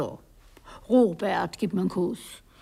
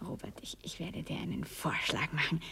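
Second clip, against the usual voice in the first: softer, whispered proposal; 4 semitones lower, 8 dB quieter than usual. Acted. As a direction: lean in and whisper the offer. Lovers' intimate bedroom scene.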